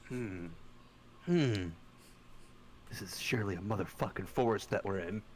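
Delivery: slightly hammed-up low musing; mock-pondering. [name] looking around the forest, lightly voiced low musing.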